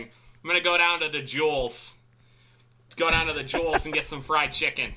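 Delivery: Chicago accent. Shown as a demonstration. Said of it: "you also have to add S's to everything".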